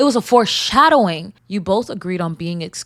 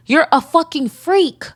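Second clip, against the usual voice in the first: higher, slow disgusted outrage; outraged insult; 8 semitones higher, 4 dB louder than usual. Real, not acted. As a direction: spit out real disgust. Outrage at the boyfriend.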